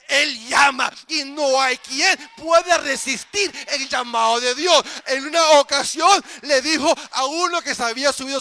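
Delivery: sustained high, shouted sermon; full-force preaching. Real, not acted. Pastor declares none can resist God's call, full-force preaching. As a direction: proclaim it at full volume, stretching key words.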